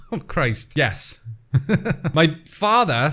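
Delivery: ordinary delivery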